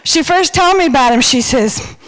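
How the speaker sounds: usual speaking voice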